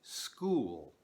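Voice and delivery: school said with no vowel before the s; gringo pronunciation. Shown as a demonstration. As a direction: start school straight on the s. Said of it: "A few examples are".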